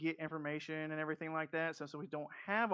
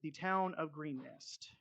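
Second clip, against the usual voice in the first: game master scene setting; 3 dB quieter than usual. Acted. DM opening the first session for the players, ominous scene narration. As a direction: draw the players into the burning town.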